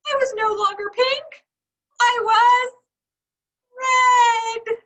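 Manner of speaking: rising in pitch into a shocked cry; the dread of seeing yourself red; dismayed cry. A narrator's reading. Horror at turning red.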